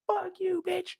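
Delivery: high screaming curse; screeching insult. Retold or imitated. Imitating Marquisha going off, high shrieking. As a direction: shriek it at her. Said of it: "Marquisha going off".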